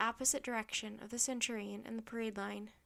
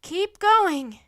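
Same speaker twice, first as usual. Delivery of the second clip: high, very loud urging; urgent shout; 8 semitones higher, 17 dB louder than usual. A narrator's reading. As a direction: shout to keep her running. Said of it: "Jack shouted to Annie".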